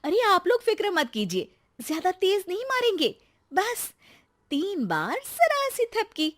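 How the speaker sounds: a wife teasingly comforting scared guests, mock reassuring. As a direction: soothe them with sly innocence. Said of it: "उन्हें दिलासा देते हुए कहती है".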